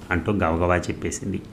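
ordinary delivery, nothing marked

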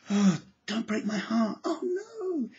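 high, hammed-up sobbing plea; mock crying. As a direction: pretend heartbreak, weepy and high.

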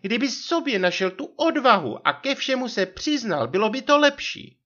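very high aggrieved wife voice; vyčítavá manželka. A narrator's reading. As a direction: press him to confess, as the high-voiced wife. Narrator voices accusing wife. (naléhavý vysoký hlas manželky)

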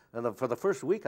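usual speaking voice

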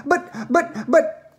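high, stuttering panic; shrieked. Acted. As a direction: splutter it high as a horrified mother. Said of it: "shrieked Missus Salt".

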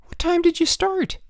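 high-pitched woman's exclamation; exclaiming. A narrator's reading. Headmaster's wife hears the boys have finished, exclaiming. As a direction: exclaim in disbelief, voice way up. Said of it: "Missus Sturgeon exclaimed".

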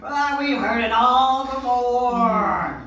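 slow, high, laughing put-on snob; mock snob. Mocking people who brag about where they're from, slow and snooty. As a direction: lord it over everyone, drawn out. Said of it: "Those people with".

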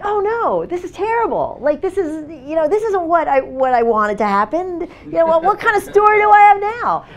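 high, rising alarm, bursting into a shout; dismayed inner thought. Retold or imitated. Recalling her panic as her story falls apart, alarmed. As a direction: panic at a story gone wrong. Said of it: "I was like".

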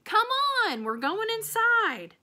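high, loud call; hollered. A narrator's reading. Opal calling Otis inside from the storm, loud. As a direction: shout it through the rain. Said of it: "I hollered at him over the rain".

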